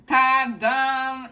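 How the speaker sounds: loud, high "ta-da"; proud little fanfare; excited reveal. Real, not acted. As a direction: present the book like a magic trick. Unveiling the book to viewers.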